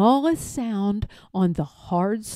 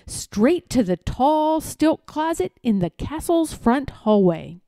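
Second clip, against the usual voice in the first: plain reading voice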